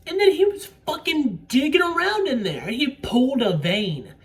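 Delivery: loud, high, emphatic outburst; worked-up exclaiming. Real, not acted. Arguing she died after he dug around, loud and worked-up. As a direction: raise your voice and pitch in disbelief. Worked-up retelling of gory scene.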